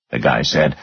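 usual speaking voice

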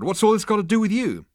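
high-pitched, loud challenge; demanding. A narrator's reading. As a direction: demand to know, pitched right up. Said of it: "demanded Zaphod".

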